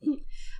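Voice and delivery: soft unvoiced laugh; breathy mocking laugh. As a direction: laugh him off under your breath. Heroine laughs at officer. (ضحكة سخرية مكتومة)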